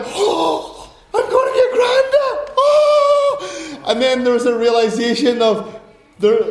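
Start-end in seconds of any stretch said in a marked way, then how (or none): 0.1-3.8 high, loud, laughing squeal of joy; overjoyed squeal. Retold or imitated. Preacher recalling his excitement at a gift, high laughing squeal. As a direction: burst out laughing with joy, much higher and louder. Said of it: "inside me, there was like".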